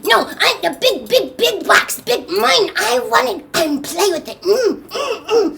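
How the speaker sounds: put-on high, insistent toddler tantrum; mad grandbaby. Grandbaby grabbing for the big box, high angry demanding. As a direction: demand it with effortful grunts. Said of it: "he is so mad. He's going".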